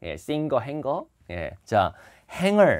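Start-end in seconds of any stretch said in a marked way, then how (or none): 2.3-2.7 행어 higher and louder, no g; careful pronunciation. Shown as a demonstration. Teacher warning students, 행어 without the g. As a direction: say 행어 as "haeng-eo", emphatically. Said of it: "이제 발음 조심해요".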